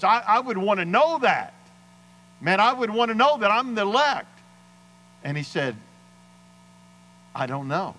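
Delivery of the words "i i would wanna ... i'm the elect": high-pitched, insistent pleading; emphatic challenge. Retold or imitated. Preacher retelling his challenge to the mechanic, emphatic and insistent. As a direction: press the point hard, pitched well up.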